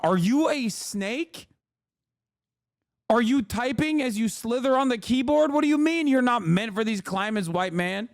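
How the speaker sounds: high-pitched, disbelieving rant; incredulous. Real, not acted. Streamer answering chatter who defends desert heat, incredulous. Incredulous retort to chat message.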